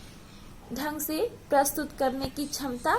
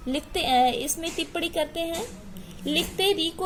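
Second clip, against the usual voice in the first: ordinary delivery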